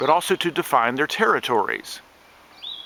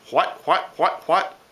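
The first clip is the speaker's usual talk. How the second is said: cardinal call imitation; 4 semitones higher than usual. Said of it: "Cardinals sound like they're saying".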